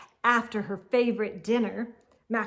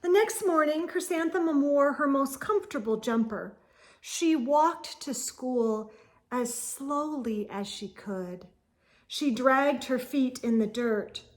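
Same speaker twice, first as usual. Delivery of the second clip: plain reading voice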